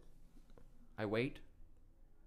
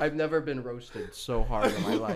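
ordinary talk, nothing marked

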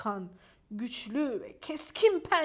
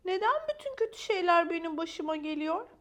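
high, plaintive why-me question; self-pity; 5 semitones higher, 3 dB louder than usual. A narrator's reading. Maya feels sorry for herself, high plaintive self-pity. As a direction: wail mildly about your own bad luck. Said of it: "kendisi için üzülüyordu".

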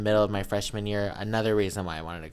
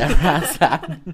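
laughing; 5 semitones higher, 8 dB louder than usual. Real, not acted. Laughs mid-line, +7 dB.

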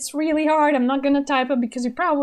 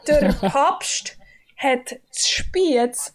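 slow, careful Swiss German tongue twister; Swiss German accent; much slower than usual. Shown as a demonstration. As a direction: spell it out in Swiss German dialect. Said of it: "So you say".

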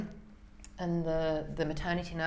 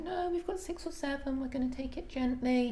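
high, light and soft correction; higher-pitched calm doctor; gentle midwife; 7 semitones higher, 3 dB quieter than usual. Retold or imitated. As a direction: gently talk the husband down. Annie corrects Ben gently.

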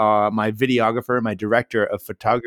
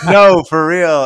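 insisting; 5 semitones higher, 10 dB louder, slower than usual. Retold or imitated. Insisting to security.

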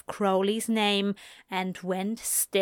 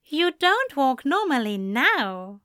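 teasing; 5 semitones higher than usual. A narrator's reading. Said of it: "Aziraphale teased".

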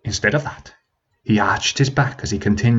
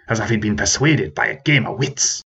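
ordinary delivery

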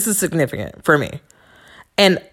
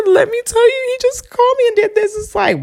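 much higher, upset rush to her sister; retold distraught. Crying to her sister on the phone, high and distraught. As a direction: blurt it out, still shaken. Calling sister while sobbing.

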